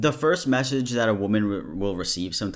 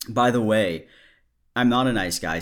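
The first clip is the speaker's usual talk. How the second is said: ordinary talk, nothing marked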